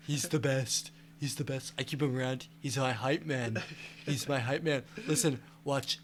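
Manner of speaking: lightly voiced Trump; a hint of boastful Trump; Trump impression.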